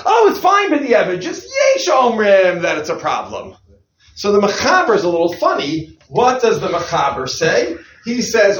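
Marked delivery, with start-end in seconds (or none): 0.1-3.6 mocking the Mechaber's sudden leniency, hammed-up and high; mock breezy dismissal. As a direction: wave the problem away in a hammed-up lenient voice. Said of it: "Over here he says".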